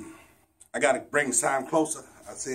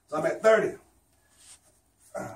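ordinary talk, nothing marked

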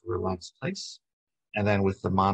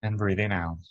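calm, even meditation guide with long pauses; unhurried body-scan voice, settling at phrase ends; guided meditation. Acted. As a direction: lead them gently through breath and body.

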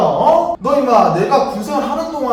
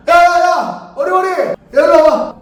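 calling out loudly; 6 semitones higher, 7 dB louder, slower than usual. Acted. Calling out from distance.

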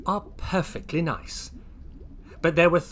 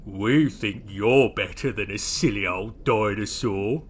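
low voice of the biggest creature; mock comfort in a low character voice; Big; 4 semitones lower, 3 dB louder than usual. Biggest naughty Lloyd flatters Oisin, in a low voice. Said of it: "inked Big".

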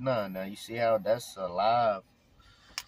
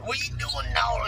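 high put-on Tillman's Corner accent; hammed-up Tillman's Corner voice; local Tillman's Corner accent; 11 semitones higher than usual. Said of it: "I started speaking in it to him".

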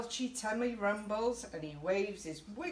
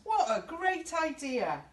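high, louder happy cry; excited cheer; 9 semitones higher, 5 dB louder than usual. A narrator's reading. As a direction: cheer like a crowd of monsters. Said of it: "cry all the monsters".

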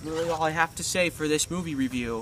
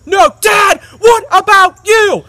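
much higher, louder, slow shout; yelling son; 14 semitones higher, 16 dB louder, slower than usual. Retold or imitated. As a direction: hurl the question back at him. Son yelling back at dad.

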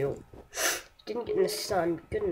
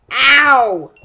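sharp yelp of pain, much louder; 3 semitones higher, 14 dB louder than usual. Real, not acted. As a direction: yelp as the hit lands. Hit in the game.

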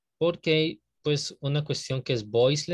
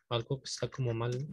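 usual speaking voice

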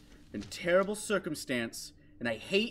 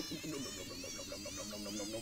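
very high, soft squeaky nope; 13 dB quieter, much slower than usual. Real, not acted. Player squeaks nope while crawling away in fear. Fleeing, squeaky string of nopes.

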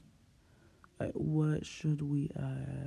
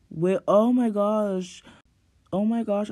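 excited; 5 semitones higher, 8 dB louder than usual. Real, not acted. Spots a great outfit.

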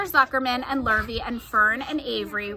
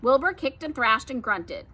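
ordinary delivery

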